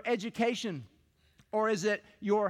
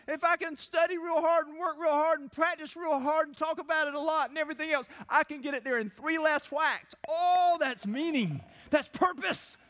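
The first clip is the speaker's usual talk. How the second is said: mock excited golfer; 6 semitones higher than usual. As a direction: ham up a golfer's zeal. Hammed-up golfer enthusiasm.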